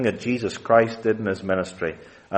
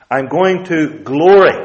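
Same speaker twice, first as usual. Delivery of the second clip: glory hit loud, higher; stress on glory; 4 semitones higher, 6 dB louder than usual. Shown as a demonstration. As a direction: punch the word glory. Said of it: "I'm emphasizing the very words".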